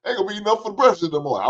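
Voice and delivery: put-on a parent scolding a boy over biscuits. Mock scolding parent voice over the biscuits. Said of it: "They said".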